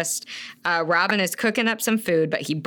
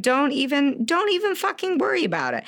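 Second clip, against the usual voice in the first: high-pitched reassurance; 8 semitones higher than usual. Acted. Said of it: "He's like".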